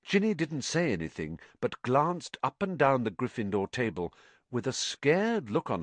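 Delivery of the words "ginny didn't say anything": usual speaking voice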